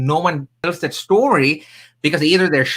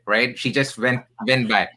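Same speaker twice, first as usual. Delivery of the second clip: normal talking voice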